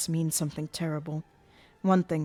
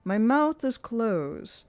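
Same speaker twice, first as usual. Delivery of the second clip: Erik ventriloquist; 6 semitones higher, 5 dB louder than usual. Acted. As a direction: toy with her like a showman.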